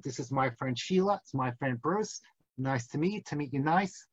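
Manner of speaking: low, soft mock Aussie accent; Australian accent. Rabbi imitates Australians introducing friends, low soft Aussie accent.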